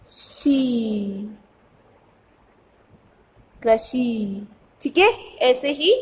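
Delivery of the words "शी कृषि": teacher sounding out कृषि, stretching the last syllable. Shown as a demonstration. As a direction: draw out शी, then blend कृषि with a long final "shee". Teacher sounds out a word slowly. (शी को खींचकर कृषि बोलें)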